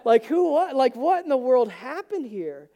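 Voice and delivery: loud, amazed exclamation; excited disbelief; amazed golfer. Retold or imitated. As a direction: marvel out loud at the perfect weather. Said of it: "being like".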